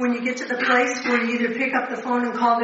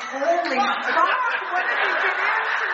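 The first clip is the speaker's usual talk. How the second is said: higher, drawn-out stunned newcomer, half laughing; mock shock; 7 semitones higher than usual. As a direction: play the newcomers' horrified surprise, a little amused. Newcomers' shocked reaction voiced.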